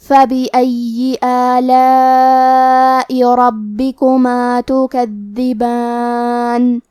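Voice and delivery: slow, melodic tajweed chant; Quran recitation. Shown as a demonstration. Teacher reciting the verse to students, slow melodic chant. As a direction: draw each vowel out in measured recitation.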